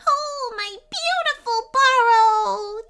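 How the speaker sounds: very high, drawn-out cheer; joyful cheering chipmunk. A narrator's reading. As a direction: rejoice loudly in a little creature voice. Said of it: "cheered the chipmunk".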